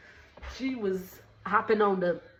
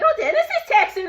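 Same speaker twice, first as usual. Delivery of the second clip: much higher, fast, giggling tease; messy tease; 13 semitones higher, 8 dB louder, faster than usual. Retold or imitated. Kelly being messy, teasing the group about Dennis. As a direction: stir the pot with a giggle. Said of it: "Kelly tried to be messy".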